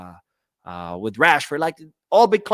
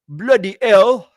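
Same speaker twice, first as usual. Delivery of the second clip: loud, drawn-out bad British accent; high, exaggerated fake British accent; terrible British accent; 8 semitones higher, 11 dB louder, slower than usual. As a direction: belt out a hammed-up British exclamation, slowly. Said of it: "That's a terrible British accent".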